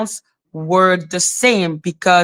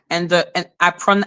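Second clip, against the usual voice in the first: ordinary delivery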